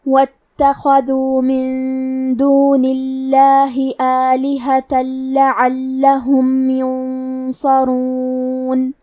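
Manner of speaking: slow, level tajweed chant with long held vowels; sustained, unhurried Quranic chanting; Quran recitation. Shown as a demonstration. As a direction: recite measuredly, drawing out hums and vowels. Recites verses between explanations.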